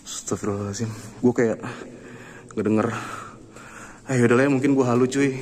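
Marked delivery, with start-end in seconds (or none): 0.1-0.9 hushed gasp. Real, not acted. Startled in dark cave.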